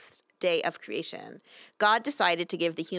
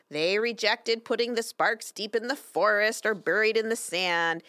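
ordinary talk

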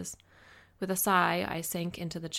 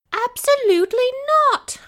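shrill, very high and loud shriek; 14 dB louder than usual. A narrator's reading. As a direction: shriek it out, outraged. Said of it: "she practically shrieked".

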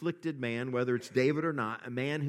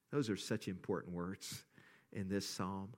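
ordinary talk

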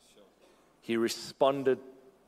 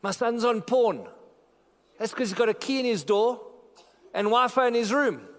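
fiery pulpit shout; preaching at full force; 8 semitones higher than usual. Real, not acted. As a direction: thunder it at the congregation. Preacher rebuking parents about Wi-Fi.

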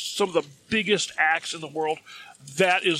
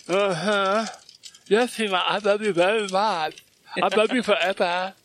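tongue hanging out; 3 semitones higher than usual. Retold or imitated. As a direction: say it dazed, tongue lolling like a smitten fan. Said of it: "that's how you talk with your tongue hanging out".

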